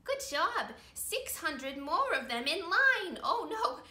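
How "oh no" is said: usual speaking voice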